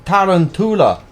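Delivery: high, loud Irish-accented word. Shown as a demonstration. Showing how the spelling trick sounds, Irish accent. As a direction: pronounce it with a stagey Irish lilt. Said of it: "say it in an Irish accent".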